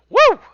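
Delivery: high, loud whoop; celebratory whoop. Real, not acted. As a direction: whoop to close the session. GM celebrates end of session.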